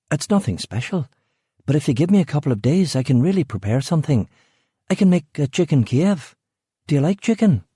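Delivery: high, fast over-eager pitch; nervous chatter. A narrator's reading. Rachel nervously selling dinner to Eric, high and quick. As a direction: talk fast to fill the silence.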